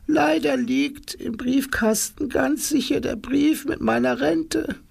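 put-on very high, frail old woman rising to a worried cry; worried old granny. Oma Rumpelputz fearing for her pension, high and anxious. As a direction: let an old lady's worry spill out. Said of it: "lächelte mit traurigen Augen".

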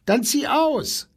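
snippy giraffe. A narrator's reading. Giraffe telling the wolf to move out, high and snippy. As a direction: fire it back high and sharp. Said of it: "meinte die Giraffe".

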